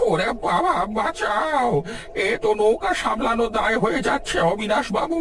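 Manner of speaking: high, frantic calls for help; panicked cry for help. Acted. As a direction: yelp it out in terror. Boat tossed in storm.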